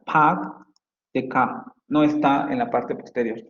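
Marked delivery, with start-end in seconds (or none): 0.0-1.6 "Park" said with a wide, open "a", no r; open vowel demo. Shown as a demonstration. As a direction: stretch the open "a" in "Park the car". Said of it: "aquí la vocal está más abierta".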